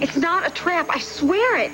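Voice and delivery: high-pitched urgent pleading; desperate protest. Acted. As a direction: swear it urgently, pitch jumping up. Protests against his accusation.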